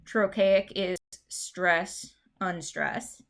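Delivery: very slow, stress then unstress; demonstrating the rhythm. Shown as a demonstration. Teacher modelling trochaic rhythm, slow and rhythmic. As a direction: beat it out, strong then weak. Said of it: "You do need to know these".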